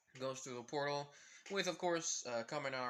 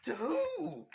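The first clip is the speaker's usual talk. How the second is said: much higher and louder, laughing through it; shocked outcry; 8 dB louder, much slower than usual. Retold or imitated. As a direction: shriek the news in disbelief. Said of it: "every girl and every boy saying".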